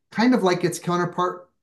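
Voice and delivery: normal talking voice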